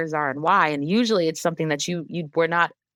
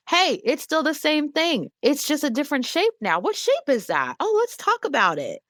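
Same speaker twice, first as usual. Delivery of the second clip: put-on high, gentle sing-song for a toddler; high, sweet reassuring parent voice; soothing mom voice; 8 semitones higher than usual. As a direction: coax a small child along, much higher. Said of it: "what comes out of my mouth is".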